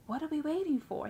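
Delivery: mildly indignant imitation of the surgeon; upset surgeon. Impatient surgeon questioning the operating staff, mildly upset. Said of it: "He was upset".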